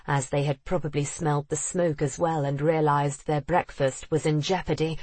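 plain reading voice

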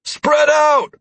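loud high battle command; shouting. A narrator's reading. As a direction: yell the order to the party. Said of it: "I shouted".

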